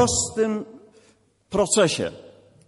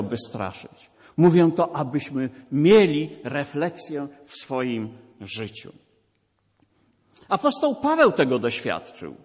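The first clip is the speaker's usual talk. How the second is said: normal talking voice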